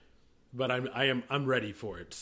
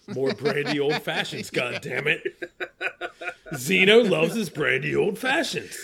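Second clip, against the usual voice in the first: loud, raised mock-indignant demand; mock outrage; 3 semitones higher, 9 dB louder than usual. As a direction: playfully make a noisy complaint. Mock demand for his favourite drink.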